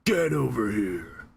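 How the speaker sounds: Mortal Kombat announcer. Retold or imitated. Imitating the game voice for the chat. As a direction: drag it out like the fighting game voice. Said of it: "the Mortal Kombat voice".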